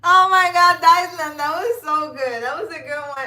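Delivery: loud, high, laughing through the words; laughing delight. Real, not acted. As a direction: burst out laughing at the joke. Cracking up at a funny comment.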